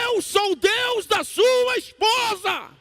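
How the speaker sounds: booming-slow, very high-pitched thunderous shout; shouted divine voice. Retold or imitated. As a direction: roar it out, stretching every word. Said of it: "veio a voz dizendo assim". (voz divina gritando, lenta e aguda)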